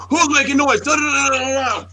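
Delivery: high-pitched yelling drill sergeant; shouted barrage trailing into gibberish. Retold or imitated. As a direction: imitate a sergeant tearing into recruits. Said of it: "drill sergeant".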